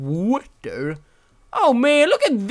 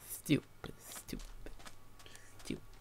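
unvoiced whisper, fading away; cursing quietly to himself; 23 dB quieter than usual. Real, not acted. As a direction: hiss it under the breath. Muttering to himself.

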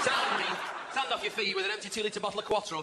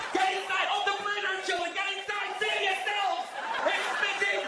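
panicking mum; 10 semitones higher than usual. Retold or imitated. Mum calling children in from the rain, high hysterical yelling. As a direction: shriek the kids indoors in a flap.

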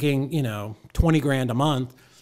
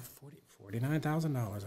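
very soft, disbelieving repeat; stunned murmur; 12 dB quieter than usual. Retold or imitated. Newcomer noting the figure to himself, hushed and stunned. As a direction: say it under your breath in awe. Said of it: "I'm like".

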